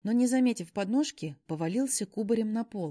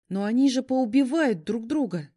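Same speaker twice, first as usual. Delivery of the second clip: louder frightened exclamation; 5 semitones higher, 9 dB louder than usual. A narrator's reading. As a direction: cry out in fear. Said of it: "Я испуганно раскрыла глаза".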